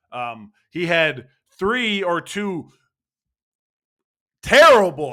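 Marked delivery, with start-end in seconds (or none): 4.4-5.1 loud exasperation. Real, not acted. Fan ranting about strikeouts.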